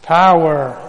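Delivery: "Power" called out loud to lead the reply. Shown as a demonstration. Pastor leads the congregation's response loudly. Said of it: "Everybody say power".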